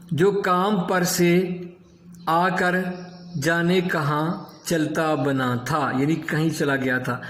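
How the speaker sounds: ordinary delivery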